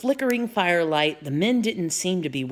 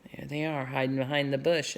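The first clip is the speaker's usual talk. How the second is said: ordinary delivery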